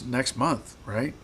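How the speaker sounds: ordinary talk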